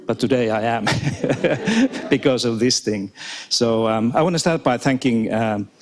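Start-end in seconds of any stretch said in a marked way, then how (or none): 0.9-2.0 a short nervous laugh, rising; embarrassed little giggle. Real, not acted. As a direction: laugh off the nerves in front of the crowd. Nervous about the crowd.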